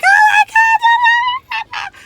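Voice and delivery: very high, loud ecstatic scream; over the top excited. Retold or imitated. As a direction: shriek with pure joy. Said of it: "over the top excited".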